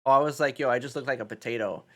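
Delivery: ordinary talk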